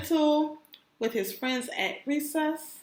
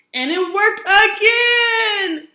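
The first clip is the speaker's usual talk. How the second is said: shouted high with glee; triumphant cheer; 8 semitones higher, 9 dB louder, slower than usual. A narrator's reading. Reading to kids, shouting the happy result. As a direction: cheer it out loud like a big win.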